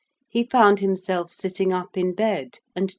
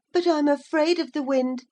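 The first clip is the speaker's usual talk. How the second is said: put-on high timid boy voice; a touch of a frightened child; scared boy; 11 semitones higher than usual. A narrator's reading. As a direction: confess the fear.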